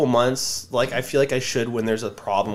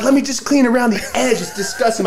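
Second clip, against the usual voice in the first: higher, louder imitation of the girlfriend; mock girlfriend; 9 semitones higher, 3 dB louder than usual. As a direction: mimic her fussing over the ear, pitched up. Mimicking girlfriend wanting to clean.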